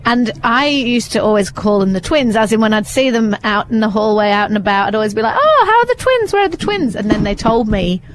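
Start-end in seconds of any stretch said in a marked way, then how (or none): 5.4-7.0 sing-song greeting. Retold or imitated. Greeting the neighbour's twins.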